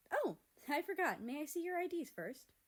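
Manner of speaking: high, bright customer-service chirp; perky waitress. Acted. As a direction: greet them like a perky server. Perky waitress remembers IDs.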